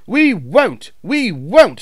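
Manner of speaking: high, loud defiant screech; stubborn trucks screeching back; screaming trucks. A narrator's reading. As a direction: trucks refusing to budge. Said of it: "screamed the trucks".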